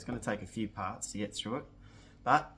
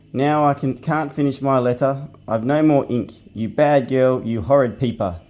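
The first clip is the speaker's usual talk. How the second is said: higher, louder scolding rant; pixie still raging; 3 semitones higher, 14 dB louder than usual. Acted. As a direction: pixie telling off the bad girl for his lost ink. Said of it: "still in a rage".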